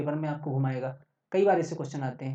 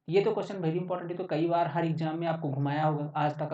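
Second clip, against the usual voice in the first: normal talking voice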